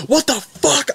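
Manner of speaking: high, cracking what the fuck in disbelief; seeing the shot, a real shock; shocked outburst. Retold or imitated. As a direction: squeal it, suddenly horrified. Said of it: "I saw that and I said".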